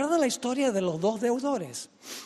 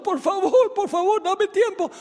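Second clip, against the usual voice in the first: very high, hammed-up pleading; imitated wailing plea of a desperate debtor; mock tearful begging; 10 semitones higher, 3 dB louder than usual. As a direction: cry out for mercy, overdone. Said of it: "sigue clamando y llorando".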